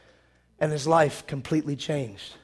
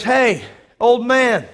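loud, drawn-out call, raised pitch; taunting challenge; 5 semitones higher, 8 dB louder, much slower than usual. Retold or imitated. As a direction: call him out across the camp. Rufio taunting Peter with a challenge.

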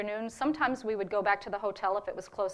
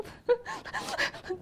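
tearful laugh. Real, not acted. Overwhelmed, weeping, thanking the givers.

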